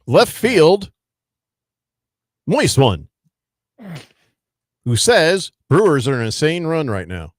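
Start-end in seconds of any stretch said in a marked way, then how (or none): 0.0-3.1 announcer voice. Acted. Announcing a listener comment segment.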